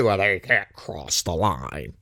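low, slow smug taunt; mock US official taunting Russia, drawn out low. Mocking US daring Russia.

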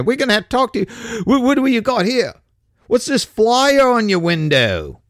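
put-on lightly done British bobby accent, slowed in places; a hint of a cockney policeman, fussy and officious; mock British cop. Cop to a burglary victim, a light mock British accent. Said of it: "y'all are more likely to look around and go".

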